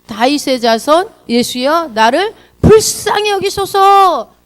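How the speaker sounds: pleading cry that swells high and loud; slow, rising plea shouted to someone passing. Acted. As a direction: start measured, then cry out long for mercy. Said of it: "뭐라고 외치죠?".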